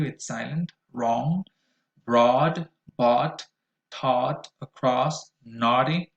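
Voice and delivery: each word said separately with a clear aw vowel; listen and repeat words. Shown as a demonstration. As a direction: model the vowel for learners to repeat. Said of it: "in the middle of words".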